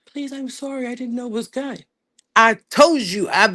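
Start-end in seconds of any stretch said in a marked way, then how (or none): 0.0-1.9 higher and much softer begging; hammed-up sorry plea; mock pleading. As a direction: whimper an apology like a caught liar. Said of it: "And then be like".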